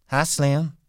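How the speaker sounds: flirty wife greets Slim aloud; bright greeting. A narrator's reading. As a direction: call it out sweetly to the passing man.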